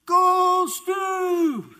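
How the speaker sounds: very high, loud, sustained holler; mom's cheering yell. Retold or imitated. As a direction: imitate a sports mom yelling to her son. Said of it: "do you have to yell so loud".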